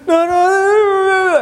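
put-on very high, slow wailing little boy; imitating crying toddler. Father imitating his sobbing son, high slow wail. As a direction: stretch each no into a child's wail. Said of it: "He said".

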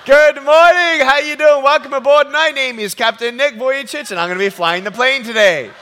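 pilot greeting. Retold or imitated. Pilot greeting boarding passengers, cheery captain voice. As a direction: greet them like a beaming airline captain. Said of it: "and then they see me".

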